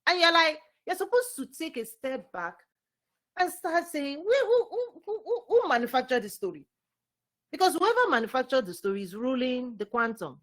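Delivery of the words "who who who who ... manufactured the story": high-pitched stammered questioning; repeated high "who" dropping into the question; acted challenge. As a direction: demand who did it, voice leaping high. Acting out questioning the story.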